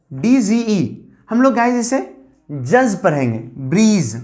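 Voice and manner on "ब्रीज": ordinary talk, nothing marked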